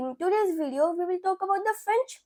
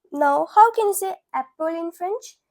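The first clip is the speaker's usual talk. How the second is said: ordinary talk, nothing marked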